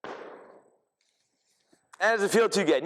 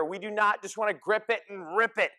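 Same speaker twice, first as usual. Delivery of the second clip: usual speaking voice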